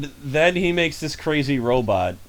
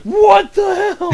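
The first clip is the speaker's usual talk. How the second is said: shocked; 9 dB louder, much slower than usual. Retold or imitated. Friends react to creepy voice.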